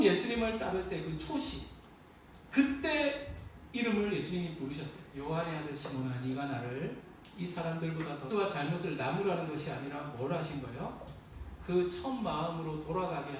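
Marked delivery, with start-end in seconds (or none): 5.2-8.3 tender question. Acted. Jesus tenderly questions Peter.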